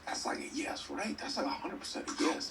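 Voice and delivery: whispering. Real, not acted. Leans over to whisper to Dreams.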